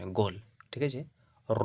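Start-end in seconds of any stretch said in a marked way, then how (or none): 1.5-1.7 র with a long rounded "aw" vowel; rounded mouth. Shown as a demonstration. Teacher models for students, র rounded and held. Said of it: "মুখটা গোল হয়ে যাবে".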